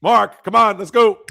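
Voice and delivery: loud call across the room. Real, not acted. Hurrying a slow chatter along, loud and pushy. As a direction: raise your voice to hurry him up.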